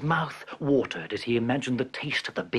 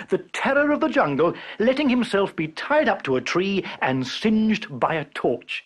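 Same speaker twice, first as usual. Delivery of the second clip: ordinary delivery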